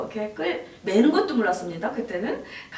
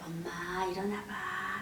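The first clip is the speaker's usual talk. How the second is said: soft, tender little request; gentle child comforting; 3 semitones lower, 9 dB quieter, slower than usual. Retold or imitated. Seven-year-old daughter to mother lying down, soft and tender. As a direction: softly coax as a young daughter.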